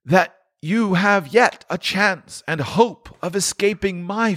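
high, solemn ghostly lament; a tormented spirit grieving over his wasted life; mournful ghost, pitched high; Marley's ghost. Acted.

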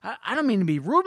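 high, eager acted voice; excited teen character. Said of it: "Summer's so excited".

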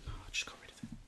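unvoiced whisper to self, off to the side; a hushed whisper under the breath; whispered aside. Real, not acted.

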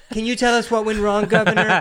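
loud, high-pitched reporter question; mock a reporter badgering the governor; hammed-up press-conference question; mock pushy reporter. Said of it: "bullshit speech where they trying to inject".

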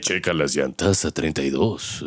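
deep villain tone at gas station. Retold or imitated. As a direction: order gas like a suave villain. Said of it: "voz de villano y habla".

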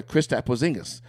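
usual speaking voice

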